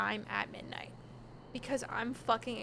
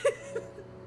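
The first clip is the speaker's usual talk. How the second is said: breathless laugh; 11 semitones higher than usual. Real, not acted. Laughing through the story.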